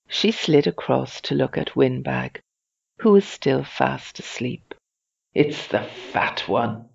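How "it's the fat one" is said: slow, low sneer; scornful. A narrator's reading. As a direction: say it slowly with disdain. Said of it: "she said scornfully".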